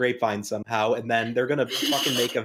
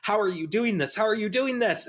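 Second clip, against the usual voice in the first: higher-pitched, pleading villain voice; exasperated villain; 8 semitones higher than usual. Acted. Villain about to be beaten by Batman, exasperated. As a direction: wail it like a thwarted villain. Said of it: "the guy, he's like".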